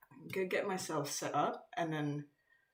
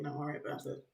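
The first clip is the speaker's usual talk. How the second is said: ordinary delivery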